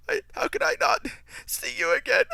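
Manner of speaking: high, wailing mock sob through the words; mock crying. Eustace sobbing farewell to the mouse, hammed-up high wail. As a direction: ham up a blubbering goodbye. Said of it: "seeing Eustace break down crying".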